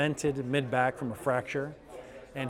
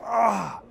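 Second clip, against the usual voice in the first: surprised gasp; 8 semitones higher, 5 dB louder than usual. Retold or imitated. Patient's amazed exclamation.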